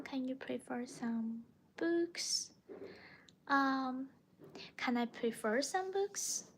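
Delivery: ordinary talk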